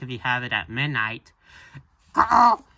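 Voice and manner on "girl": lightly squawked girl impression; loud, badly faked girl voice; Ami. Reader trying an impression of Ami, odd squawky voice. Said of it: "trying to do an impression of Ami".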